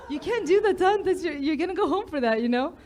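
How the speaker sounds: laughing mock disbelief; laughing protest. Laughing, retelling her protest.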